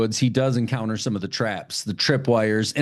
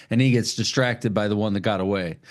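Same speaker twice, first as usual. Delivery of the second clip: usual speaking voice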